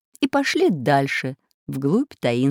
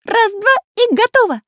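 much higher, chirpy character voice; high cartoon voice; 7 dB louder than usual. A narrator's reading. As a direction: squeak it out like a toy pencil. Magic pencil character speaks.

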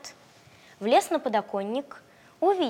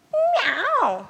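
high meowing cat; 13 semitones higher, 5 dB louder than usual. Acted. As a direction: meow like a cat. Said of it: "сказала кошка".

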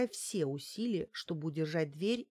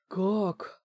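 louder one-word question of shock; shocked gasp; 2 semitones higher, 5 dB louder than usual. A narrator's reading. Housekeeper hearing of the arrest, shocked. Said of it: "выдохнула домработница".

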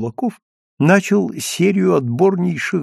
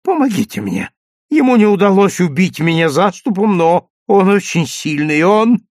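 breathless, louder plea for help; panting; 5 semitones higher, 4 dB louder than usual. Acted. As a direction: gasp it out while struggling. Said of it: "задыхаясь".

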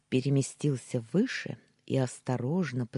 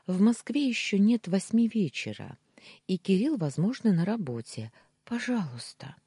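higher-pitched hopeful pleading; 6 semitones higher, 3 dB louder than usual. A narrator's reading. Wife persuading her husband to allow the call, pleading. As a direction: reason eagerly, then beg.

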